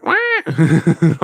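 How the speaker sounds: sudden imitated squawk of a bird; magpie squawk. Streamer imitating loud Canadian magpies, sharp squawk. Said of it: "they're like".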